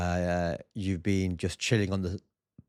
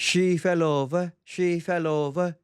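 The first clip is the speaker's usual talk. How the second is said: slow, higher mocking chant repeated; teasing sing-song; 7 semitones higher, 3 dB louder, slower than usual. Husband mocking his wife's fall, slow singsong tease.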